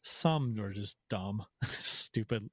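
ordinary talk, nothing marked

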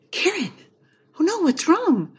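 worried spouse finding someone upset; concerned husband. Retold or imitated. Husband finds his wife crying, worried and high. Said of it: "he stops and he sees me and he says".

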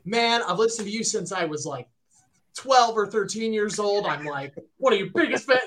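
imitating own fangirl babble. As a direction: gush like a hopelessly devoted fan. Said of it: "I start fangirling".

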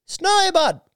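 high-pitched calling for a pet. Retold or imitated. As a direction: call the lion like a lost dog. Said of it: "he's like".

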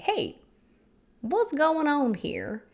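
high, slow, curious question; gentle curiosity. Acted. Meditation host models asking oneself about feelings, curious. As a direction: poke around with a lilting, inquisitive voice. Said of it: "with curiosity, not shame or guilt".

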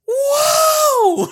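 high, loud alarmed shout. Retold or imitated. Mother finds out what the pills were, shouting in alarm. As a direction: yell it in shock. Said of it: "And she goes".

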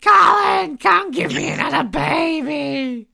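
high, drawn-out caricature of a wife; Jamie impression. Co-host playing the wife calling husband, high silly wail. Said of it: "imitating Jamie, where I do the voice".

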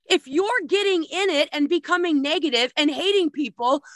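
ordinary delivery

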